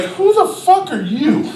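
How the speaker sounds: high, slow challenge; mock defiance. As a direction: voice a toddler's insolent look. Toddler's defiant look voiced.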